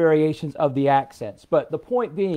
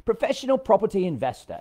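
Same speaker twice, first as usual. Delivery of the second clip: higher-pitched, pompous mock British; pompous British accent; 5 semitones higher than usual. Mimicking how British investors introduce themselves, pompous British accent. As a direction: puff it up in a hammed-up British accent. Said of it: "they were so pompous about it".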